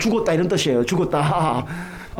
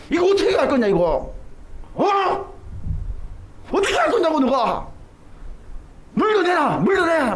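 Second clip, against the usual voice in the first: higher, raised scolding voice of an angry adult; mock angry demand for payment; angry scolding man; 6 semitones higher than usual. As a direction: tell the kid off like a furious neighbour. Angry motorcycle owner scolding boy.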